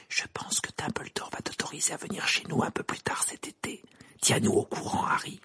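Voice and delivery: unvoiced confidential whisper. Madame Weasley to Harry at parting, whispered. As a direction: whisper it into the ear. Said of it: "lui murmura à l'oreille".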